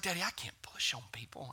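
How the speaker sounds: daughter's shy little whisper; timid whisper. Retold or imitated. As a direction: whisper it like a nervous kid. Sweet daughter voiced, unvoiced whisper.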